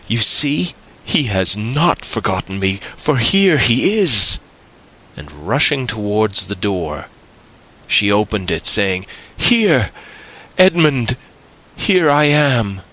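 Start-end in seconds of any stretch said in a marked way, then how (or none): 0.1-4.4 put-on high, elated young woman; triumphant joy, swooping high; overjoyed. A narrator's reading. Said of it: "blushing with delight, and fairly leaping in excess of love". 9.4-12.8 high, loud and elated; excited high-pitched cry; joyful calling out. A narrator's reading. Joyfully calling out to her lover.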